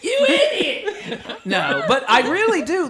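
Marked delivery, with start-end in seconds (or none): 0.0-1.4 very high, stretched-out comic yell; hammed-up screaming scolding; mock scream. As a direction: scream the insult slowly, close to laughing. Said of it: "Jesus screamed at me".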